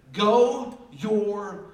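very slow, loud, stretched words; emphatic scripture proclamation, drawn right out; declaimed command. Acted. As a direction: boom out each word separately.

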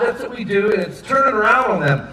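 normal talking voice

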